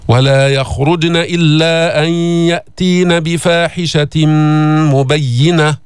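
chanted, بفاحشة مبينة joined by a held nasal m; ghunna in recitation. Shown as a demonstration. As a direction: hum the merge of بفاحشة into مبينة. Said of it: "شو هالغنة اللي جبناها". (ترتيل بفاحشة مبينة بإدغام وغنة)

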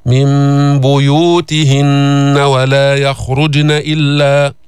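slow chant, يخرجن with a light bounce on ج; qalqala on jeem. Shown as a demonstration. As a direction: recite slowly, echoing the ج in يخرجن. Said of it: "قلقلت الجيم الساكنة". (ترتيل يخرجن بقلقلة الجيم)

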